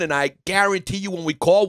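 normal talking voice

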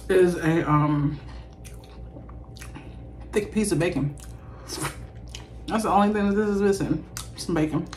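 speaking between bites; talking while chewing. Real, not acted. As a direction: mouth full of food. Seen 1: Eats burger throughout; cheeks full and chewing between phrases, bites at 9s.

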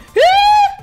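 loud, high-pitched sharp gasp; startled gasp. Real, not acted. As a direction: gasp loudly and cut yourself off. Cuts herself off mid-guess.

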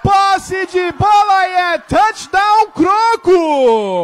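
loud high excited shout; touchdown call. Real, not acted. Second touchdown called.

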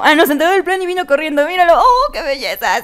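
loud, high, rushed delight; playful high-pitched excitement; excited cheering. Real, not acted. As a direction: gush loudly as your pet runs up. Cheers as Mac comes running.

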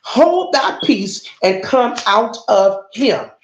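slow, weighty "Hold thy peace"; slow rebuke. Shown as a demonstration. Preacher reads Jesus' rebuke to the church, slow and weighty. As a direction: lay each word down slowly like a command. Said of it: "Listen, "And Jesus rebuked him".

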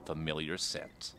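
reading a pulpy crime novel aloud, measured; story reading. Acted. Reads Varric's novel aloud.

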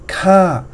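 "ka" starting high and dropping; falling tone. Shown as a demonstration. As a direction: begin "ka" up high, then let it fall. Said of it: "falling tone".

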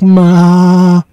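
loud, high bleating maa; goat bleat. Acted. Parent reading animal noises, loud goat bleat.

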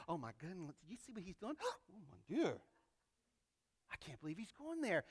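hushed, scandalised church gossip with a gasp; mock pious gossip. Preacher mimics self-righteous crowd gossiping about Jesus, hushed and scandalised. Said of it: "They're all talking as if they're saints".

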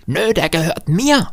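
put-on high-pitched stubborn little boy; possessive child. A narrator's reading. Boy keeping candlestick from driver, high stubborn voice.

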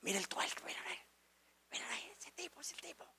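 hushed whisper of onlookers; murmuring gossip. Retold or imitated. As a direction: whisper furtively, barely audible, as gossiping schoolmates. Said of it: "todo el mundo está murmurando". (cuchicheo de compañeros murmurando)

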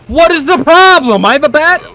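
loud, high-pitched indignant yelling; fed-up gamer yell, voice way up; exasperated shout. Real, not acted. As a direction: shout in outraged disbelief. Player exasperated at alarmed crowd.

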